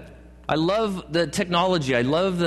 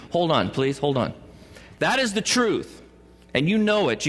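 usual speaking voice

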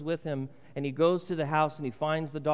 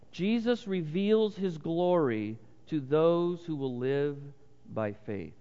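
slow deliberate statement; slower than usual. Shown as a demonstration. States the point a third time.

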